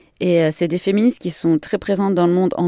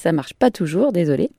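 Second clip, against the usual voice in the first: ordinary delivery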